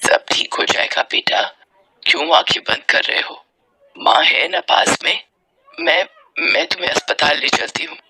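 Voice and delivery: low, breathy motherly voice; hushed, anxious comforting; worried mother. Acted. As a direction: soothe a child who cannot breathe.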